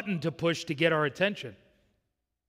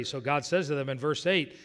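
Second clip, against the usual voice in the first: normal talking voice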